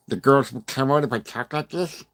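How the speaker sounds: teasing lisp voice; mock lisp. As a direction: put on a playful lisp to tease chat. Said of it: "With lisp?".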